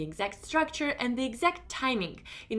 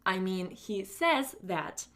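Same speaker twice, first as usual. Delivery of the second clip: demo self-correction; 3 semitones lower, slower than usual. Shown as a demonstration. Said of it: "and then you say the same thing, but with a corrected mistake".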